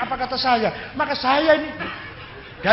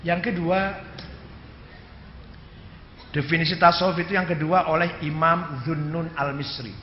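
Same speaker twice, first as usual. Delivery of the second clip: ordinary talk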